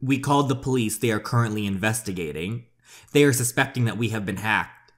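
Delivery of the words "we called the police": ordinary talk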